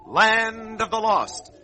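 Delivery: ordinary talk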